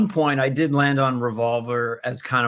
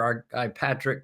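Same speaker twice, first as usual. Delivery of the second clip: usual speaking voice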